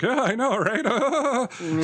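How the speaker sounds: put-on high-pitched, laughing girl's agreement; mock eager girl.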